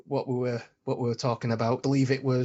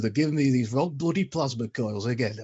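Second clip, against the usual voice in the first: a grumbling Yorkshire engineer, voice pushed off its usual sound; Yorkshire accent. Retold or imitated. Audition line in a grumbling Yorkshire accent. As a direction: moan about the plasma coils in a broad Yorkshire accent. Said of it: "a Yorkshire accent".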